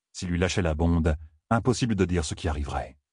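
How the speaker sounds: ordinary delivery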